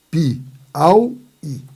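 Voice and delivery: Piauí in three parts, pi, au, í; syllable split. Shown as a demonstration. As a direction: pause between pi, au and í of Piauí. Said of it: "tá aqui minha sílaba".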